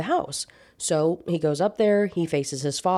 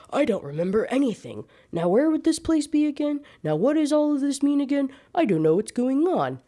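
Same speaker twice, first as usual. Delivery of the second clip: mocking confused voice; 8 semitones higher than usual. Mocking repetitive confused character.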